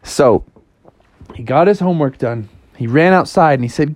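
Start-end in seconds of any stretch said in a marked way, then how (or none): none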